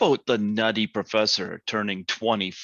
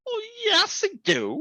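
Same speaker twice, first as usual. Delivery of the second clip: playful insistence. Real, not acted. Playful pizza argument.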